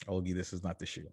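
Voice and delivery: quiet, let-down father voice; hushed disappointed verdict; disappointed dad. Retold or imitated. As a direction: say "this is not the shoe" softly, letting him down. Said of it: "I heard the disappointment in his voice".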